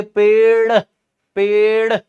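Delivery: पेड़ with the tongue flicked down fast; retroflex flap in पेड़. Shown as a demonstration. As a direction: say पेड़ with a quick flapped ड़. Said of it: "जीभ ऊपर गई और फिर तुरंत नीचे आई है". (पेड़ में जीभ ऊपर से झटके से नीचे)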